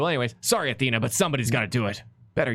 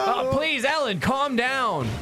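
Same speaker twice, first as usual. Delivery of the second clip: much higher, drawn-out plea to calm; high, slow soothing; 13 semitones higher, much slower than usual. Acted. Lawyer calming his sobbing client, high and slow. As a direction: coax her down, slow and high.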